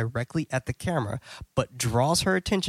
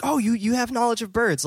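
high-pitched imitation of an excited woman; retold excited exclamation, pitched way up; 11 semitones higher than usual. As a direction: gush with delighted surprise, playing her. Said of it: "very excitedly".

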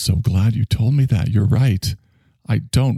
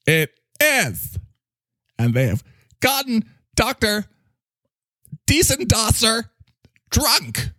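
higher, alarmed herald of doom; high, dramatic character voice; 14 semitones higher than usual. Acted. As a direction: announce the critics' invasion in a high, theatrical voice. Character voice continues.

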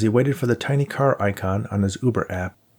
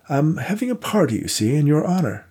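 put-on elderly gentleman voice; 7 semitones higher than usual. Worthington invites her.

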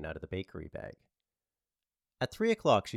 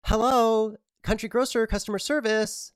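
much higher, chirpy customer-service lilt; cheery voice; 14 semitones higher, 10 dB louder than usual. Acted. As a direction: sing it out like a bubbly phone clerk. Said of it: "said the cheery voice".

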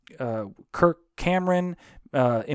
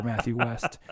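usual speaking voice

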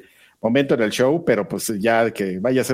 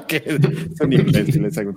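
laughing through the words; 4 semitones higher than usual. Real, not acted. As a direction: chuckle while insulting them. Laughs at own political joke.

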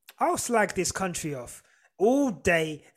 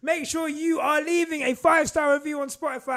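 podcast plug; 6 semitones higher than usual. Acted. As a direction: sell it to the listeners. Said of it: "podcast outro plug".

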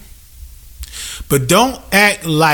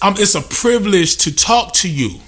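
ordinary delivery

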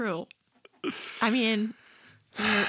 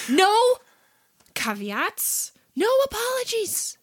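high squeaky hype; 11 semitones higher, slower than usual. Acted. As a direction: jump way up in pitch. Hyping the segment intro.

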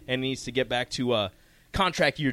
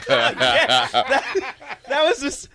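laughing; 9 semitones higher, 6 dB louder than usual. Real, not acted. Laughing recalling friend's reaction.

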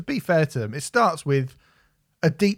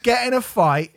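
laughing rant; 9 semitones higher than usual. Real, not acted. Urging the lads to go wild, laughing and high. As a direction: egg the band on, cracking up. Ranting advice at band.

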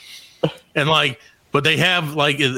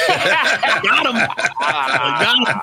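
laughing; 5 semitones higher, much slower than usual. Retold or imitated. Laughing triumphant reply.

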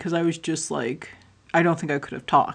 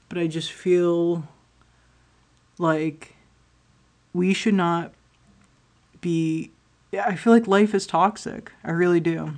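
normal talking voice

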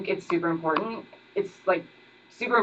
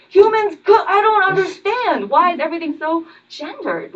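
high, baffled disbelief; mock confusion; 12 semitones higher, 8 dB louder than usual. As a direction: play dumb, high and loud. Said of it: "I'm like".

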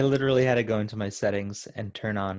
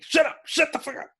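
mock yell; faster than usual. Friend reenacting a movie scene, high mock shouting. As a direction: yell it like a raging clerk. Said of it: "he's just like".

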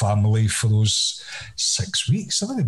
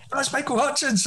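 Michael announcing himself; 13 semitones higher, 4 dB quieter than usual. Retold or imitated. Retold phone call, Michael voiced.

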